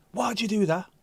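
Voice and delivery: scolding mum. Retold or imitated. Retold mum questions the child.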